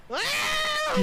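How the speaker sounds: sudden high yelp of shock; surprised yelp. Real, not acted. Sudden shock at game unlock.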